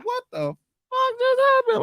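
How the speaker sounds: very high, slow squeal of disbelief; high-pitched mock astonishment; retold shock. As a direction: stretch it out high in comic shock. Retold shocked reaction to news.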